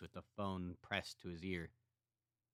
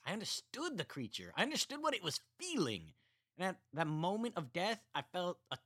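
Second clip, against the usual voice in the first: Sven telling his story; 7 semitones higher than usual. A narrator's reading. Sven telling Roger about his mother, character voice. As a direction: tell it flatly, a little too calm. Sven's voice continues.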